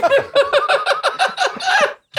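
long high-pitched cackle; high cackling laugh. Real, not acted. Big laugh at joke.

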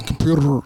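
Memphis-style "computer", ending on a thick curled R; Memphis hard R. Shown as a demonstration. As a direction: lean hard on the final R of "computer". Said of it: "put the capital R on the end".

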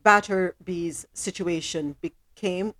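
measured, sober news read. Acted. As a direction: deliver the story straight. Reads the news.

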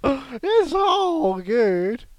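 very slow, stretched out; drawn-out. Pretend. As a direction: linger on every word. Drawn-out impression line.

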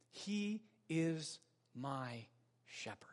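soft and very slow, dropping away; hushed emphasis. Acted. Preacher softens at the climax of a list of praises. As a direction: let "He is my shepherd" fall to near silence.

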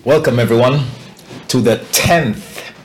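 loud, high, stretched showman welcome; host intro. Acted. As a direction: open the podcast like a game show. Show host opening.

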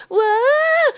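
high, drawn-out mock whoa; imitated wail of someone toppling; startled cry. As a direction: yelp as if losing your balance. Acts woman losing her balance.

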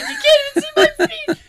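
high, loud, laughing mock whisper-brag; laughing imitation. Sean hiding feet in the stall, high laughing imitation. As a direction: squeal it through laughter. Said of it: "He's like".

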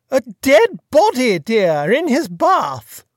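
put-on chatty, high-pitched grand old lady; imperious plummy grande dame. A narrator's reading. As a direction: gossip like a fluttery duchess. Duchess repeats slowly.

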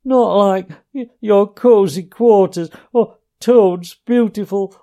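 high, loud, broken sobbing pleas; sobbing brokenly. A narrator's reading. Mole to Ratty, longing for his lost home, sobbing brokenly. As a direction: choke the words out between sobs. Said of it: "he sobbed forth at last brokenly".

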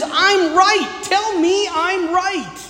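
high, arrogant insistence, the second line slow; arrogant demand. Shown as a demonstration. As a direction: demand to be told you're right. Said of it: "it's so arrogant".

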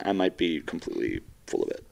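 normal talking voice

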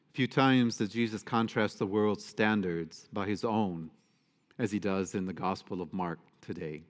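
ordinary talk

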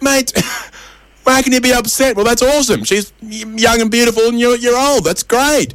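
very high incredulous voice; hammed-up joke-character enthusiasm; acted amazement. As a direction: pitch it way up, baffled and thrilled. Other man in joke, incredulous.

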